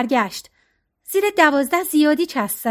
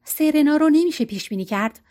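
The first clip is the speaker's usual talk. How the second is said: usual speaking voice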